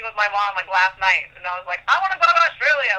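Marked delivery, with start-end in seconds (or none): 1.9-3.0 eager retold wish. Retelling her own eager wish.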